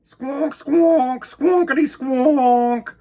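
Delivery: high loud honking squonks; taxi horn honk. A narrator's reading. Reader imitating the taxi horn, high and loud. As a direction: honk like a taxi horn, stretching the last one. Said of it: "tooted a taxi horn".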